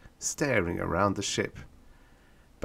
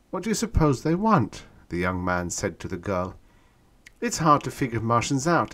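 higher, louder wondering voice; puzzled question; 7 semitones higher, 4 dB louder than usual. A narrator's reading. Young man and girl wondering about the Martians, puzzled. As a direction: puzzle it out aloud.

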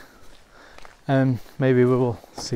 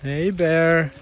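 calling out; 4 semitones higher than usual. Real, not acted. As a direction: call out on the trail. Calling out to warn bears.

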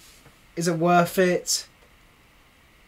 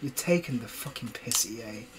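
usual speaking voice

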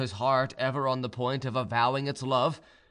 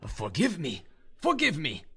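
plead, second time more urgently; pleading cry; 3 semitones higher than usual. A narrator's reading. Young man begging her forgiveness, an urgent cry. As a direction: cry out, rising on the repeat. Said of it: "cried the young man".

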